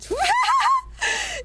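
sudden high, shrill burst of laughter; shrieking laugh. Real, not acted. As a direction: cracks up into a high squeal. Laughing at own joke.

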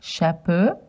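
chapeau as sha-po with rising tune; French pronunciation. Shown as a demonstration. Asking children to repeat chapeau. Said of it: "Can you say chapeau?".

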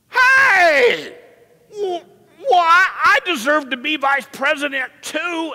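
loud, high-pitched mock whine; whiny voice. Preacher mimicking the ten indignant disciples, whiny. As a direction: wail it like a whiny boy demanding his share. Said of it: "they said it in a whiny voice".

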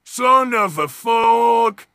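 ordinary delivery

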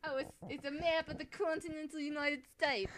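Woody Allen. Pretend. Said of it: "You sound like Woody Allen".

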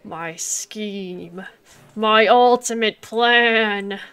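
slow, loud evil mastermind; villain gloat. Pretend. Bragging about the vending scheme, hammy villain. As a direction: drag it out like a cartoon villain.

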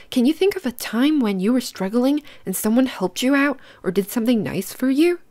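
plain reading voice

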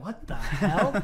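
drawn-out, baffled question; baffled disbelief. Retold or imitated. Roommate hears bangs on the door, slow bafflement. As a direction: stretch it out in mock disbelief. Retells reaction to banging.

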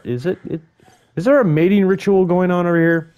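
high, loud nature-show wonder; high teasing voice at the dinos; high mock curious. Real, not acted. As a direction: squeak it out in amazement.

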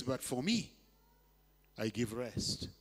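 low, soft, tender voice of Jesus; gentle Jesus. Acted. Jesus inviting the weary to rest, low and soft. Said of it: "And he says".

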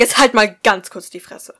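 loud, cutting shout; angry interruption. Acted. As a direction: shut him up hard. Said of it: "unterbrach der Abiturient den Freund". (Thaddeus fährt Tobias laut ins Wort)

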